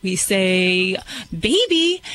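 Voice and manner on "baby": put-on "baby" sung out much higher, rising at the end; sweet upward call of "baby"; name with rising voice. Shown as a demonstration. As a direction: call "baby" high, voice lifting up. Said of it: "and your voice goes up".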